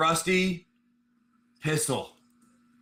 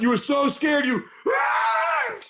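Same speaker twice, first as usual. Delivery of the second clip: much higher, a hammed-up frightened shout ending in a scream; mock scared yell; 10 semitones higher, slower than usual. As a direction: yelp like a startled fighter, played for fun. GM acting the scared enemy.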